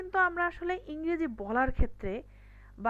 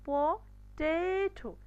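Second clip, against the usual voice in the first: Potato slowly broken, PO, then long TE-to; lengthened middle syllable; 4 semitones higher, much slower than usual. Shown as a demonstration. Teacher demonstrating duration, middle syllable drawn out. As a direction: pause after PO, stretch the TE of potato. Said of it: "টেটাকে কিন্তু একটু লম্বা করে বলেছি".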